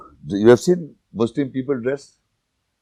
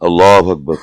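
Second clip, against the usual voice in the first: loud prayer call Allahu Akbar; a loud, lightly voiced prayer call; 4 semitones lower, 6 dB louder than usual. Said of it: "let's do prayer".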